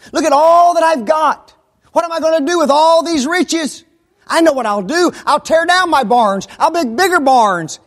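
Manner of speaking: loud, high bragging rich farmer; boastful rich man. Retold or imitated. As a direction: crow over your riches. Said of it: "that rich farmer".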